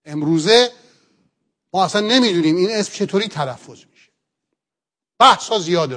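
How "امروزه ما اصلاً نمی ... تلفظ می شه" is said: ordinary talk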